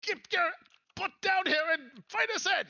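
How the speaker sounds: loud, very high character shout; high-pitched hammed-up order; impatient summons. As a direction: yell upstairs in a squeaky put-on voice. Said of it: "He says".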